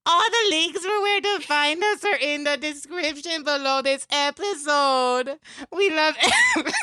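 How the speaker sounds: Mort. Acted. Podcast host reads the outro to listeners, Mort impression. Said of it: "You can do your Mort voice".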